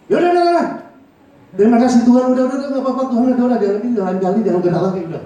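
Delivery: loud, high, fast brush-off of God, udah udah udah; impatient, rude thanks. Retold or imitated. A grudging believer after a loss, brusque loud thanks. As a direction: snap out a curt thank-you. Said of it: "nggak sopan, nggak ada etika".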